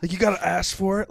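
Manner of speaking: high, loud comic gripe; loud mock indignation. Griping to a friend about Arby's sauce, loud mock indignation. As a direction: protest it like a petty outrage.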